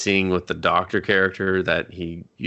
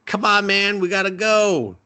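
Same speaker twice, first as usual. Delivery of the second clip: high whiny voice; 12 semitones higher than usual. Acted. Henchmen voiced urging Bruce away.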